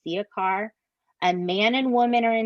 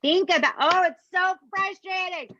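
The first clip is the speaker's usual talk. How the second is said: exasperated; 8 semitones higher than usual. Real, not acted. Exasperated about police failures.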